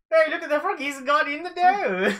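high, jokey put-on voice; playful silly voice. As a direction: go high and silly, stretching the last words. Playful joke about the frog.

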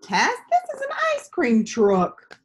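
a hint of a kind friend correcting her; patient boy answering. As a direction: friend Gus gently correcting Tess, lightly voiced. Gus answers Tess in story.

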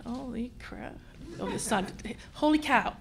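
muttered thought. Retold or imitated. Inner thought, self-correcting.

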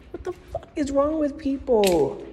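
ordinary talk, nothing marked

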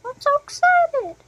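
high, bright-eyed cry of excitement; excited squeal. Acted. Eager child gushing about the trip, high excited squeal. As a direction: squeal it out like a thrilled little kid. Gleeful character voice.

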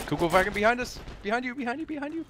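alarmed callout; urgent warning. Real, not acted. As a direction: pitch shooting up on each behind you. Warning teammate of enemy.

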